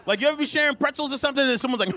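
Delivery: ordinary talk, nothing marked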